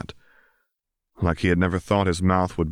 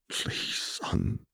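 breathless, barely-there whisper; hoarse whisper; 3 semitones higher, 10 dB quieter than usual. A narrator's reading. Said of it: "in a hoarse voice barely above a whisper".

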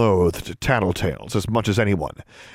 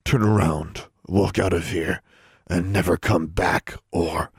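low, growled warning; growled threat; 4 semitones lower than usual. A narrator's reading. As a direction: growl it low, trailing into menace. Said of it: "John squeezed and growled".

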